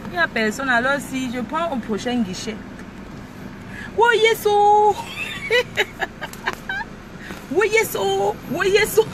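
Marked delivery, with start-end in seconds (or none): 4.0-4.9 high, loud gleeful oh yes; excited whoop. Acted. Driver whooping along to her song, excited. 5.0-7.4 very high, thin giggling squeal; squealing laugh. Real, not acted. Driver squealing with laughter in her car, giddy. 7.5-9.1 high, bright oui yes oh; excited whoop. Acted. Driver cheering herself on at the wheel, excited.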